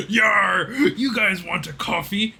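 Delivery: loud, slow, hammed-up Bristol pirate shout; pirate Bristol accent. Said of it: "It's a Bristol accent".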